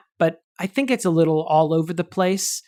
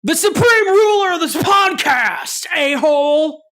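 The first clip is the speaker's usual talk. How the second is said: high, slow hammed-up yell in a put-on voice; Nicolas Cage shouting; 10 semitones higher, 5 dB louder, slower than usual. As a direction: bellow it like a manic movie star. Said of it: "I'll be Nicolas Cage".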